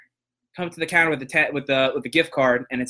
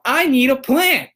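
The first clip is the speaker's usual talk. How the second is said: high, loud and slow, spelling it out; hammed-up pushy customer; impatient demand; 10 semitones higher, 5 dB louder, slower than usual. Impatient customer loudly demanding a plant from the cashier, high and slow. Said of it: "she goes".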